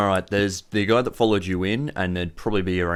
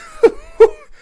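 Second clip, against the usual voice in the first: loud high-pitched acted giggle; laughter winding down; 13 dB louder than usual. Boss recovering from his fit, high leftover giggle. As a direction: let the last helpless cackle out. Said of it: "a few moments later, he recovers".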